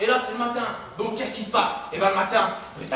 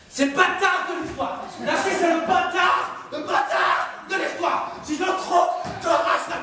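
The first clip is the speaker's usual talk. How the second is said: mock outrage; 6 semitones higher, slower than usual. Real, not acted. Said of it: "narrator insults Narcisse".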